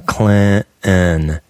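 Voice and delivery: CLINTON as "Cla'an", T dropped, nasal half N; French-sounding half N. Retold or imitated. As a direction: swallow the T in Clinton, nasalize the end. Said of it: "that French-sounding half N".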